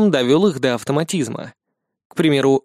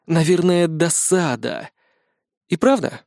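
ordinary delivery, nothing marked

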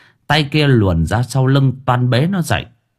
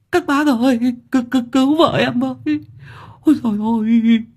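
much higher, wailing lament; wailing husband; 5 semitones higher than usual. Acted. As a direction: wail in despair over his wife. Husband wails over injured wife. (than khóc ai oán, giọng cao vút)

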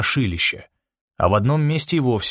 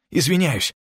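mildly strained, higher-pitched apology; lightly acted hoarse call; hoarse gasp; 6 semitones higher than usual. A narrator's reading. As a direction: toss out a croaked apology on the run. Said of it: "прохрипел Макс".

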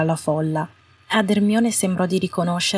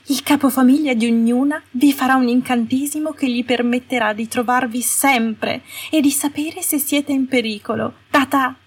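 sugary Umbridge; 7 semitones higher than usual. A narrator's reading. Umbridge's sugary announcement to prisoners.

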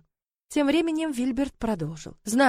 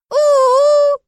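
long, high, loud wail; sobbing howl; 12 dB louder than usual. Acted. Little dragons crying in chorus, high wailing howl. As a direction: bawl like baby dragons. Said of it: "прорыдали хором мелкие проказники".

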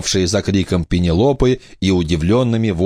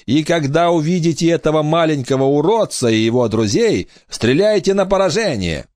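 squeaky pirate captain; 7 semitones higher than usual. Acted. Captain commands crew.

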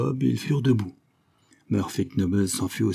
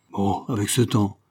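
plain reading voice

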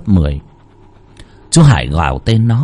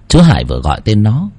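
plain reading voice

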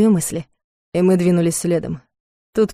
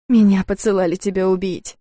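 plain reading voice

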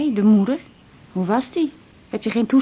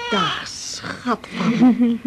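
very slow tender greeting; cooing to baby; much slower than usual. Acted. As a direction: coo it to the grandchild. (oma koert heel langzaam tegen de baby)

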